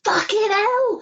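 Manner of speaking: high-pitched, thrilled exclamation; excited outburst. Real, not acted. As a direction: blurt it out, overwhelmed by the news. Blurted reaction to Deadpool news.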